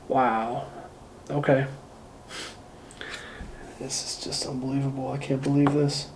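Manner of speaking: barely audible angry mutter; fuming under breath. Shown as a demonstration. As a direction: seethe quietly to yourself. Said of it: "just starting to get pissed off".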